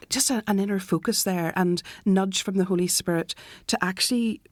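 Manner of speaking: usual speaking voice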